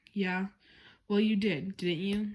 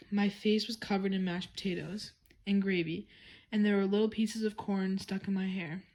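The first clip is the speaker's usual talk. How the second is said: usual speaking voice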